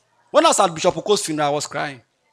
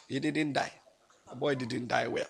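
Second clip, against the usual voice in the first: low, soft quoted explanation; doctor's hushed verdict; 6 semitones lower, 14 dB quieter than usual. Retold or imitated. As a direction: drop low and quiet, trailing almost to nothing. Acting out doctor's verdict again.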